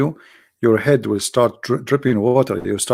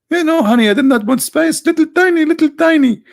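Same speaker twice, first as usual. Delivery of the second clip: very high-pitched mock wife voice; imitating a wife; 4 dB louder than usual. Wife assuring husband she barely used spice, hammed-up high falsetto. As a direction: squeak out a hammed-up, innocent denial to your husband.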